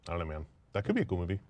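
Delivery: normal talking voice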